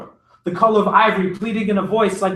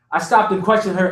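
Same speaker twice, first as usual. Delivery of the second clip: ordinary delivery, nothing marked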